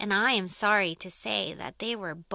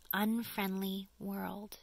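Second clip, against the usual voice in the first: ordinary delivery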